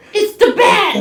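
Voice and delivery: high, loud Brooklyn henchman yell, laughing; Brooklyn accent. Retold or imitated. Mocking Joe Rogan as a Brooklyn henchman, loud yell. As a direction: yell it like a Brooklyn goon in an Arkham game. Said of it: "In a Brooklyn accent".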